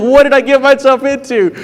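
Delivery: high, panicked inner voice; a sudden rush of alarm; panicked thought. Retold or imitated. Substitute facing a wild class, high and panicked.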